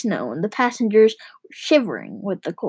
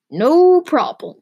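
loud, high, stretched-out cheerful call; 5 semitones higher, 6 dB louder, slower than usual. A narrator's reading. Driver brushing off a worry, shouting gaily. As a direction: sing it out carelessly, long and loud. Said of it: "he shouted gaily".

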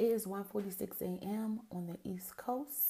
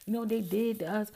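higher, louder indignant rant; imitating the guy's outraged excuse; 4 semitones higher, 7 dB louder than usual. Guy explaining why he hates dogs, indignant loud. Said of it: "I'm like".

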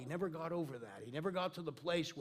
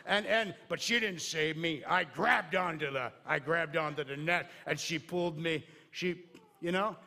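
loud, excited bug recounting the rescue; lightly voiced bug, projected loudly; bug telling its tale; 8 dB louder than usual. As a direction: keep boasting to the other bugs. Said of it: "Can you imagine that bug talking to the other bugs".

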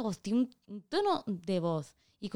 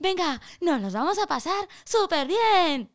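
YouTuber parent voice; 7 semitones higher, slower than usual. Retold or imitated. Imitating a kid YouTuber parent.

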